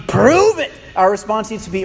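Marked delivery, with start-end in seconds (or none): 0.1-0.7 high, loud demand; defiant challenge. Acted. Doubter demanding proof from God, loud and defiant. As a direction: throw it back as a challenge. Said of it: "should not be".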